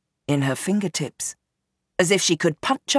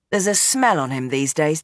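plain reading voice

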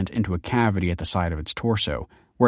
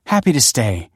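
stiff; 5 semitones higher, 5 dB louder than usual. A narrator's reading. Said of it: "said Kelsey stiffly".

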